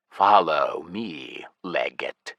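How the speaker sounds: low, slow undead voice; lich voice. Acted. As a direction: speak like a cold ancient lich. Lich commands.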